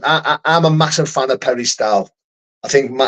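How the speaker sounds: ordinary talk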